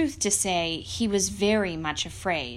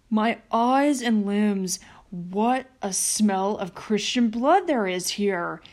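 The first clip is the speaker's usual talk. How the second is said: a lightly growled suspicious giant, a bit louder; 3 dB louder than usual. Acted. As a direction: sniff out the intruder like a giant.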